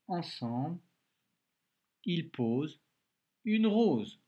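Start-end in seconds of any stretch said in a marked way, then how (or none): 2.1-4.2 higher, louder, brisk reread of the sentence; cheerful reread. Shown as a demonstration. Teacher rereads the sentence with the pupil, brightly and loud. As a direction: read it back together brightly to finish. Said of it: "on relit ensemble".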